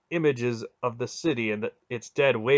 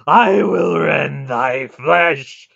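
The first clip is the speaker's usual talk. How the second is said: high, slow, furious shout; full-force wrathful bellow; shrieked threat; 6 semitones higher, 13 dB louder, much slower than usual. A narrator's reading. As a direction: shriek it, dragging out every word. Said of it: "he shrieked at her".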